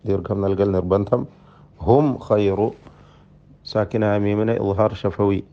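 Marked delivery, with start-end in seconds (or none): 1.9-2.7 ഹും with a plain m before ഖൈറു; clear unhummed meem. Shown as a demonstration. Teacher shows students clear meem, ഹും without hum. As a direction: keep the m of ഹും free of hum. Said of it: "മണിക്കാൻ പാടില്ല".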